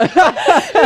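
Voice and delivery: bright burst of laughter; real laugh. Real, not acted. Wife laughing as she retells her husband's reaction, real laugh. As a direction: laugh out loud at the memory.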